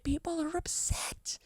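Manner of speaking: breathy mocking whisper of upset people. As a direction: hush it like a sarcastic aside.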